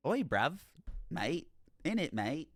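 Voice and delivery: London slang; cheeky cockney slang; rough London slang. Retold or imitated. Mock London slang reply.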